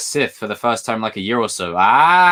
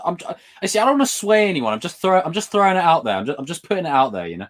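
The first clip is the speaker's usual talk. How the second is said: ordinary delivery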